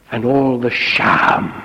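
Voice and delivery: ordinary talk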